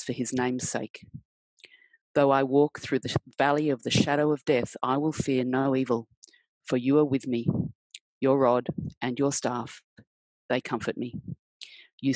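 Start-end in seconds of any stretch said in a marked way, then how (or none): none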